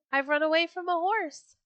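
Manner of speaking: high, loud climax of a taunt; gleeful boast. A narrator's reading. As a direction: brag it out to your pursuers. Runaway boasts, building through list.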